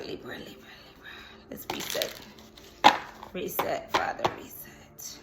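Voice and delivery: real whispered muttering to Father; hushed prayer. Real, not acted. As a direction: whisper it softly, as a private prayer. Praying to Father to reset.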